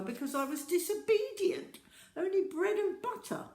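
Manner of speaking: mock a mother handing out punishment; scolding mother.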